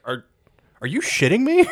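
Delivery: high, rising incredulous question; hammed-up indignant surprise; mock disbelief. Jack hearing the history, incredulous. Jack told ancient people are recent.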